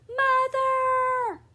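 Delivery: high, loud panicked scream; girl runs screaming to her parents, terrified; screaming child. Acted. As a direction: shriek for your parents in terror. Child calls out.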